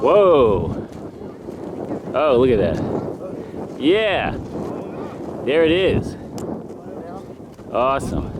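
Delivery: loud, high whoops of awe; excited cheering. Real, not acted. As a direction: call out in real amazement, loudly. Volcano comes into view.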